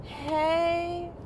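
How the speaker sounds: high, drawn-out sing-song hello; cheery greeting. Real, not acted. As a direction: greet the viewers brightly.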